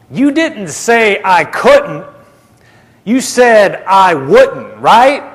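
slow, high and emphatic on "couldn't" and "wouldn't", rising "right"; insistent, disbelieving check. Retold or imitated. Brother Hagin pressing Jesus on the word, insistent disbelief. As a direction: press each word, pleading for agreement.

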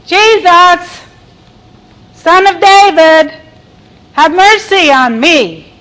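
high, loud plea for mercy; crying out. Acted. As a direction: call out desperately over a crowd. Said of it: "he began to cry out".

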